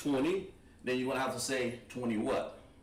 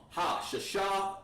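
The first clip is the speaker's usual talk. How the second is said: Ha Shasha spoken very slowly, Ha higher; slow demo; 7 semitones higher, much slower than usual. Shown as a demonstration. Teacher slowly models the phrase for the class. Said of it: "Shasha is the sixth".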